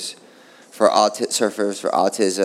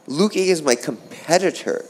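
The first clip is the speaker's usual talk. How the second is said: normal talking voice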